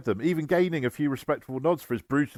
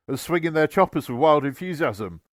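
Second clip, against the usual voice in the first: ordinary delivery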